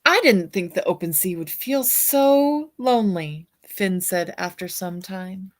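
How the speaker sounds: put-on bright, lively read-aloud for children; storybook reading. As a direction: read it like a bedtime picture book to kids. Reads picture book aloud.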